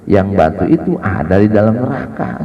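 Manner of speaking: ordinary delivery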